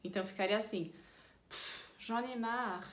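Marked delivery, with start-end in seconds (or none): 1.5-2.9 huffed, slow and weary; irritated, fed up. Shown as a demonstration. Teacher shows having had enough, irritated and huffing. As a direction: puff out air, then drag the words. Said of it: "tá irritado e que não aguenta mais".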